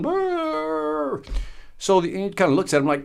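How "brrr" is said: high drawn-out dog moan; hound dog groan. Retold or imitated. Speaker imitating the hound dog's moan for the audience. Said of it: "laying there going".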